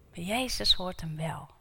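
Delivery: normal talking voice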